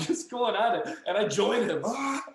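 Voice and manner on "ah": scaring-off yell. Retold or imitated. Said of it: "just screaming at these wolves".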